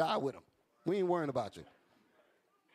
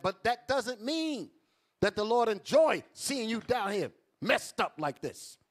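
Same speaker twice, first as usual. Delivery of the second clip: ordinary talk, nothing marked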